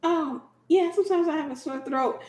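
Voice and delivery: high, naive answer; innocent mom. Retold or imitated. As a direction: play it totally clueless. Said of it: "my mom was like".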